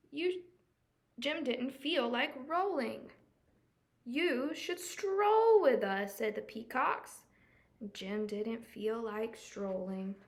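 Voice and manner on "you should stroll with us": proud peacock. Acted. Peacocks inviting Jim.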